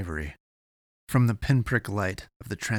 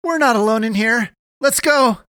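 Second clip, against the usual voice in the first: high, loud alarm; urgent warning shout; 12 dB louder than usual. A narrator's reading. Eddie warns Avery they have company, urgent shout. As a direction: yell to get someone moving now.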